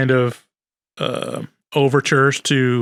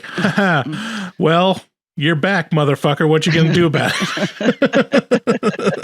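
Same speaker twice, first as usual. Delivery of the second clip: lightly voiced gloating Trump, a bit higher and laughing at the end; mock Trump taunt; 4 semitones higher than usual. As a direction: taunt them like a smug bully, half-joking. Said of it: "It's like".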